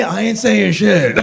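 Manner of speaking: slow, deadpan evasive dad; lightly voiced homophobic dad dodging; old dad voice. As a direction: deny it slowly like a sly old man. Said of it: "So they'll be like".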